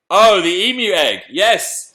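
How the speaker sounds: high, loud delighted "oh"; excited recognition. Real, not acted. Host reminded of the egg by a viewer, excited. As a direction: burst out as you remember it. Reacts to question about egg.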